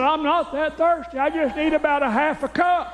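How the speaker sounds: high, put-on old dad voice; dad's voice. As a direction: ask it like a thrifty old father. Dad's reply voiced in story.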